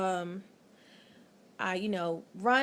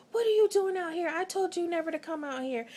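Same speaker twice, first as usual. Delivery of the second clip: scolding; 8 semitones higher, faster than usual. Retold or imitated. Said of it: "he was like".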